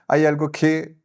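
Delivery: ordinary delivery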